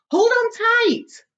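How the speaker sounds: high, loud and slow warning; urgent shout. Acted. Grasshopper warning everyone as the floor heaves, shouting. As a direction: call out to hold on.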